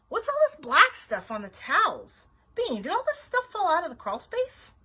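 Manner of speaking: high, loud accusing outburst; exasperated big-sister scolding; indignant scolding. A narrator's reading. Nancy scolds Bean over dirty towels.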